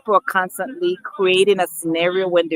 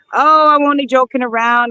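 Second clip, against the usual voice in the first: higher, louder breezy brush-off, lightly imitated; mock worker; 6 semitones higher, 6 dB louder than usual. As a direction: toss it off like the worker shrugging.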